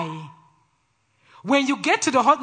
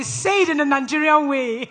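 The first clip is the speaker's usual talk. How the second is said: harsh, brash teasing; 5 semitones higher, 5 dB louder than usual. Retold or imitated. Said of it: "sometimes it comes out so harsh".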